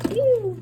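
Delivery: high playful "Pew!" like a bad smell. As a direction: say "Pew!" high and playful, holding your nose. Book sound effect again.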